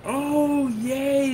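high, drawn-out happy cheer; real pleased surprise; delighted relief. Real, not acted. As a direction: let out a high-pitched little hooray. Screen content came back.

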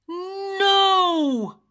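loud, high, drawn-out cry; wolf's howl. A narrator's reading. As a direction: howl it out in storybook dismay. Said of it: "howled the wolf".

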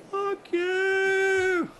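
imitating a shark screaming abuse. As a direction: shriek it from the dark like a taunt. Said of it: "just screaming".

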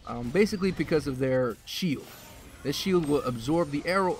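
ordinary talk, nothing marked

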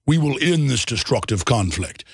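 lightly voiced deep film villain; Darth Vader. Shown as a demonstration. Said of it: "talk like Darth Vader".

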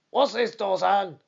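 put-on loud gruff demand from an angry old trainer; fierce trainer calling out to his groom in anger; cried out. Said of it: "he cried".

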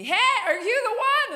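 loud, high call from afar; yelling across the street. Retold or imitated. A stranger hollering at him from across the street, yelling. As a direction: shout to catch his attention.

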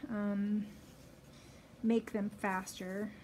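muffled behind fabric mask; speaking through cloth covering; through a face mask. Real, not acted. Seen 5: pink fabric mask covers nose and mouth throughout labelled words.

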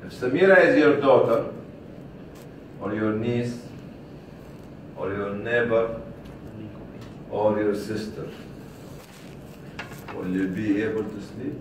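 ordinary talk, nothing marked

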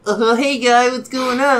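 high, loud dopey imitation; mimicking the storyteller. Stranger in his jacket imitating him, mocking. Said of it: "I'm gonna be you".

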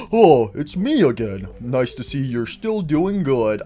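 low character voice. Acted.

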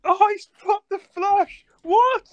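high, loud stunned cry; shocked. Real, not acted. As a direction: gasp it out in shock. Shocked at bad beat.

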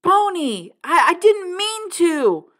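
high, loud, slow anguished scream. A narrator's reading. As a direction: scream after him, stumbling with regret. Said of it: "Derry screamed".

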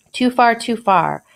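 mild alarmed cry of too far; exclaiming. Acted. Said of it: "exclaimed the good man".

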